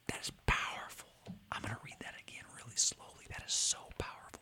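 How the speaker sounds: hushed, whispered aside to himself; awed whisper. Real, not acted. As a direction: whisper it low, struck by what you read. Awed aside to self while reading.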